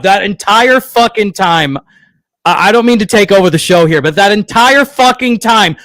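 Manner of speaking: loud, raging rant at a broken stream; real frustrated shouting into the mic; furious yelling. Real, not acted. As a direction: yell it out, fed up and swearing. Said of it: "just start yelling in the middle of me hosting".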